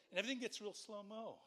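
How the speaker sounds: normal talking voice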